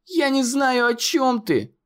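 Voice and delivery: very high, panicked protest; desperate cry. A narrator's reading. Conor fighting the smoke, crying out. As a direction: shout it out of desperation. Conor struggling against the monster.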